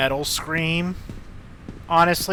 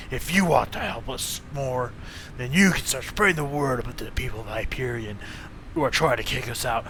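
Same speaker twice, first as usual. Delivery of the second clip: gang thug voice; 4 semitones lower than usual. Acted. Thug voice continues.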